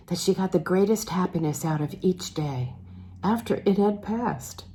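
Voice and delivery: ordinary delivery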